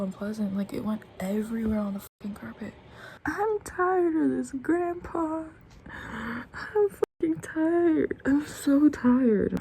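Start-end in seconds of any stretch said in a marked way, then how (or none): 3.3-5.4 high, drawn-out tired wail; worn-out, high-pitched complaint; exhausted whine. Acted. Exhausted, whining out a quoted line. 5.9-9.6 slow, high tired moan; high, weary complaint; exhausted whine. Real, not acted. Worn out, complaining of no sleep.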